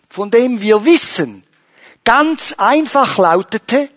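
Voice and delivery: high and loud, driving each claim home; impassioned preaching. Acted. Pastor rousing the congregation about Jesus as owner, impassioned preaching. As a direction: preach it out to the congregation with full force.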